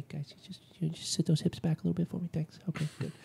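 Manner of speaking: meek rookie coach whispering to the class; mild-mannered Mike. Retold or imitated. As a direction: mumble the cues shyly, as a timid new coach. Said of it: "he's a very mild m-, tempered kind of guy".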